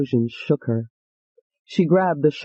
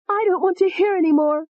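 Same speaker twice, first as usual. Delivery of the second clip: distressed cry; 13 semitones higher than usual. Acted. Ashley covers her ears.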